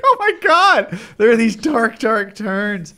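shocked gasp. Retold or imitated. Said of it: "You're like".

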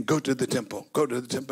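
low, soft repeated urging; quiet inner voice urging Simeon; Spirit's prompting. Retold or imitated. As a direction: hush it like an inner nudge.

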